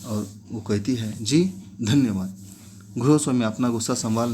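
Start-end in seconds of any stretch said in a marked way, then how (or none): 1.2-2.4 trembling thanks. Acted. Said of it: "कांपते हाथों से वो रूबल लेती है".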